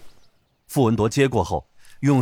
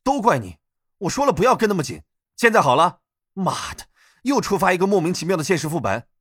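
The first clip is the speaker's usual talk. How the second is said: raised, fast and heated blame; angry scolding; 6 semitones higher than usual. Acted. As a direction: snap at your partner angrily. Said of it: "楼道里回荡起齐恒和女医生的骂声".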